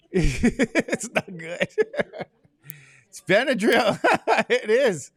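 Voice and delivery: laughing through the words. Real, not acted. Teacher laughing to class about Benadryl. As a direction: giggle while admitting it.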